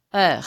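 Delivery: "R" said as the guttural French R. Shown as a demonstration. Teacher demonstrates the French R, unsure of herself. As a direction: rasp "R" at the back of the throat. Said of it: "ちゃんとは言えませんけども".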